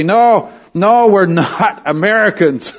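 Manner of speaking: high, laughing no no; high-pitched laughing correction; laughing protest. Retold or imitated. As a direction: laugh through the denial. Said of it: "I had to say".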